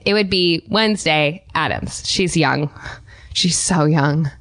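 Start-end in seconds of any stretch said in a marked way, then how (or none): 2.1-4.3 low deadpan. Acted. Creepy deadpan remark.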